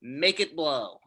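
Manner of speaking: ordinary talk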